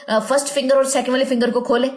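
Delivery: ordinary talk, nothing marked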